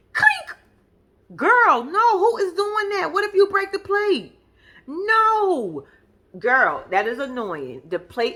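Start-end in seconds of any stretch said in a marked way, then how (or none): 0.1-0.6 high squeaky clink of knife on plate; imitated clink. 1.3-5.9 outraged. Real, not acted. Outraged reaction to plate cutting.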